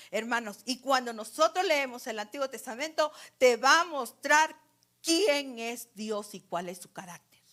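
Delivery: loud, impassioned sermon voice; a pastor's urgent plea to know God; preaching at full force. Acted. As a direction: press every question on the congregation. Fiery preaching.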